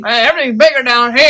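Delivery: put-on Texas accent. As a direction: brag like a proud Texan.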